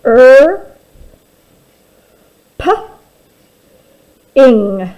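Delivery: loud isolated sounds; teacher sounding out chirping piece by piece; sounded-out parts. Shown as a demonstration. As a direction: break the word into its sounds. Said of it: "I N G ringing".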